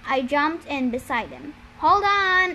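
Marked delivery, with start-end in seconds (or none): 1.8-2.5 loud, high warning shout; yell. A narrator's reading. Boy about to cut ropes, yelling. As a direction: shout it out to brace them. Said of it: "I yelled".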